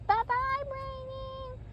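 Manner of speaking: very high singsong farewell; squeaky high goodbye; listener character's voice. Acted. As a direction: call goodbye in a tiny high voice. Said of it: "And you said".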